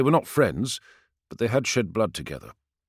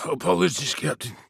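pained grunt; 7 semitones higher than usual. Acted. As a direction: force it out through pain. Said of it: "he grunted".

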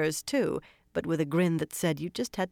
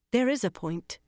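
insistent; 6 semitones higher than usual. Acted. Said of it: "Elaine said insistently".

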